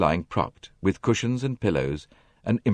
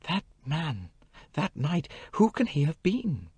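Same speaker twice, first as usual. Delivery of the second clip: put-on young woman, hushed; 10 semitones higher, 5 dB quieter than usual. A narrator's reading. Jackie frightened, voiced by narrator.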